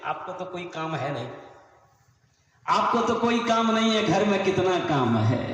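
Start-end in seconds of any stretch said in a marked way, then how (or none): 2.7-5.4 louder, indignant wifely complaint; scolding wife. Acted. Wife refuses husband who wants pajama shortened, loud scolding. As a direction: act out a wife telling him off loudly.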